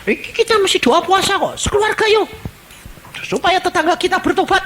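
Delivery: high, quick eager plea; rally the family to pray; urging family. Acted. Believer urges family to fast for neighbours, high and eager.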